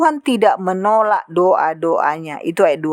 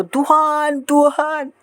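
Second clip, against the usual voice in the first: higher, drawn-out call, imitated; imitated pleading call; 6 semitones higher, much slower than usual. Hypocrite calling on the Lord, imitated drawn-out plea. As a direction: stretch the name out like a showy worshipper.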